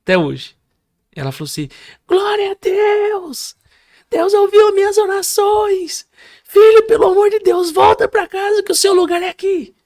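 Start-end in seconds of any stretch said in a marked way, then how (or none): 2.1-9.7 very high, loud, drawn-out cries; wailing, high-pitched joyful pleading shout; mother's screams. Retold or imitated. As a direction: imitate a mother crying out to God. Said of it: "Aqueles gritos que ela dava".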